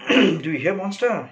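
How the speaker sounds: high, loud angry call to a monster; shouting. Acted. Stefano rounding on Caliban, shouting. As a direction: raise pitch and volume sharply. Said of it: "he shouts".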